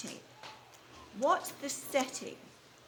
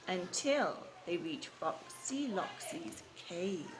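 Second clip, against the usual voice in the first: dropped lower and softer, slow; ominous storytelling; 4 semitones lower, 6 dB quieter, slower than usual. A narrator's reading. Teacher reading to children, low and ominous. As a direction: hush it as the danger arrives.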